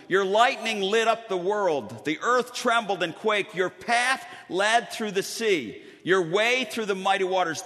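scripture reading. Acted. Preacher reads Psalm seventy-seven to the congregation, proclaiming. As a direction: declaim it like a preacher in full flight.

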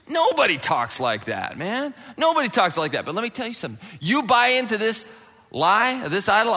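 usual speaking voice

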